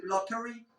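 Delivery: lottery with a clear T, "lot-er-ee"; British lottery. Shown as a demonstration. Teacher asking about the British version, lottery with true T. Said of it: "Los británicos dicen lottery".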